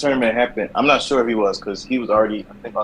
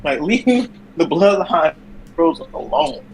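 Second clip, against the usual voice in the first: mocking; 11 semitones higher than usual. Mocking Seth Rollins.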